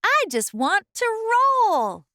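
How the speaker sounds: high, loud eager voice; eager acorn. A narrator's reading. Acorn telling oak he wants to roll, eager. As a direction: beg to be let go. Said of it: "said Acorn".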